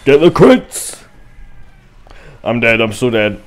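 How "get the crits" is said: loud, high battle yell; excited shout. Real, not acted. Gamer yelling at his team to get the crits, loud and high. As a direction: yell it out mid-fight, higher and louder.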